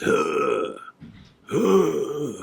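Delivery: high strained heaving gasps; dry heaving. Retold or imitated. Joey at the sink, imitated dry heaving. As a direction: retch out two high dry heaves. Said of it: "I could hear him dry heaving".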